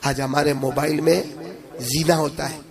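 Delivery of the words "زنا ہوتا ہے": ordinary talk, nothing marked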